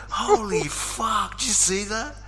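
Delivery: high-pitched, drawn-out mock yell of amazement; headphone-deaf exclamation. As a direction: blurt it out, oblivious, as if wearing headphones. Retells shouting over headphones on treadmill.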